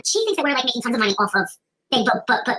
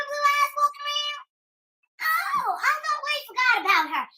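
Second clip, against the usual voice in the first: mock outrage; 7 semitones higher than usual. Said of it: "So I said".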